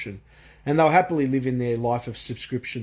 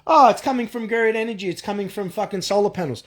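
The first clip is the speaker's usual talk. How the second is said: high, louder sing-song mock of a naive believer; 8 semitones higher, 4 dB louder than usual. Ranting vlogger mocks green energy fans, high mocking voice. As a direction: parrot the believer's line in a silly, dim voice. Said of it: "how fucking stupid are people".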